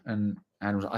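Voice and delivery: usual speaking voice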